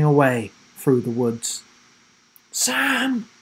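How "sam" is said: high, strained call on little breath; winded wheeze. A narrator's reading. Will winded on the ground calls after Sam, wheezing. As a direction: call out with the wind knocked out of you. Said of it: "wheezed Will".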